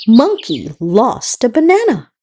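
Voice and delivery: plain reading voice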